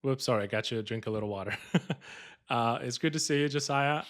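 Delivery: usual speaking voice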